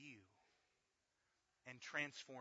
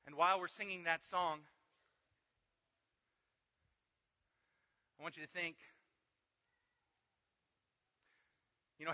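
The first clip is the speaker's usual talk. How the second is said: loud, high, driving sermon; full-force preaching; 6 semitones higher, 12 dB louder than usual. Acted. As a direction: preach it out hard to the room.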